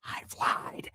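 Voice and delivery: unvoiced hushed admission; a sheepish whispered confession. Real, not acted. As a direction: whisper it like a guilty secret. Confessing the lie.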